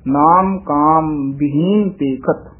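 ਬਹੀਨ said slowly with a short i after ਬ; very slow ਨਾਮ ਕਾਮ ਬਹੀਨ ਪੇਖਤ; careful recitation. Shown as a demonstration. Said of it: "ਬਹੀਨ ਉਚਾਰਨ ਹੋਵੇਗਾ".

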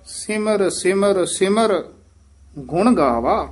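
slow Simar Simar Simar, halting after the third Simar; pause on the third Simar. Shown as a demonstration. Teacher shows the pause after the third Simar. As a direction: stop briefly on the third Simar. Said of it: "ਤੀਸਰੇ ਸਿਮਰ ਪਦ ਤੇ ਠਮਕ ਹੈ".

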